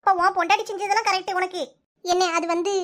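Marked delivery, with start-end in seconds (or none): 2.1-2.7 trailing off unsure, dragging the words; stall, searching for an answer; hesitant stalling. Acted. Sister-in-law stalls when her brother demands answers, hesitant. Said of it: "அது வந்து, இது வந்துன்னு இழுக்கிற".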